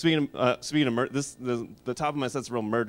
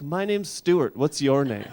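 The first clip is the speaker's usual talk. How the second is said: lightly voiced kid; 4 semitones higher, 6 dB louder, slower than usual. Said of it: "He's like".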